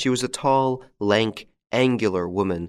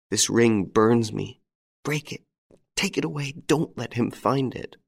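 frightened pleading; 5 semitones higher than usual. A narrator's reading. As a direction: beg him to take the ring away. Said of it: "She sobbed".

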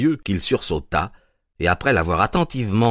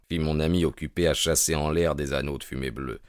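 plain reading voice

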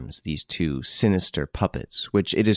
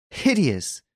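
hideous cried out higher, full voice; horrified cry; 8 semitones higher than usual. A narrator's reading. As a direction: burst out hideous in horror. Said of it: "cried Hortense".

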